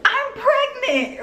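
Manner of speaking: high, drawn-out retold reveal; sing-song mock announcement through a smile; smiling announcement. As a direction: break the news high and slow, smiling. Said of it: "But I'm, like, smiling".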